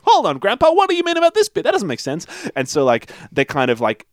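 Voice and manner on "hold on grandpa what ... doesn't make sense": put-on kid voice. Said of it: "he's like".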